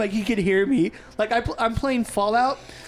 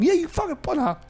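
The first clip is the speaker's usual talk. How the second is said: mock scolding; 6 semitones higher than usual.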